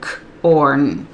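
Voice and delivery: CORN split into K, then ORN; segmented sounds. Shown as a demonstration. Tutor modelling say and write corn, K and ORN apart. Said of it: "They need to hear it segmented".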